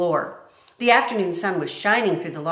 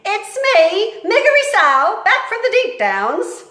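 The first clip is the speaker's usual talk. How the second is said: high, loud hollered greeting; loud high country-girl shout; Mig shouting; 11 dB louder than usual. Acted. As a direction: holler it like a thick-headed servant girl. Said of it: "she shouted".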